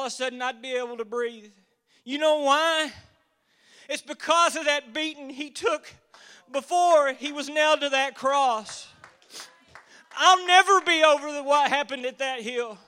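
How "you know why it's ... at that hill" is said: loud, ardent preaching. Acted. Preacher testifying about the cross, ardent loud preaching. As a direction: proclaim it with full conviction.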